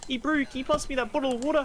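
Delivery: high, lightly mimicked Kiwi bloke; New Zealand accent. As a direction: imitate a laid-back New Zealand mate. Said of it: "he's like".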